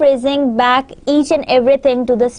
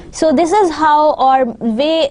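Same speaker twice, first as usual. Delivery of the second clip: normal talking voice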